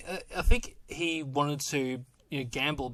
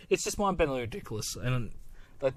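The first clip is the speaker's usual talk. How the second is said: ordinary delivery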